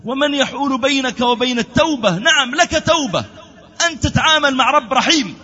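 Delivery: high, emphatic preacher's voice; impassioned preaching. Acted. Preacher assures a sinner of repentance, impassioned preaching. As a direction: preach it high, stretching key words.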